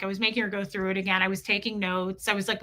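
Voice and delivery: normal talking voice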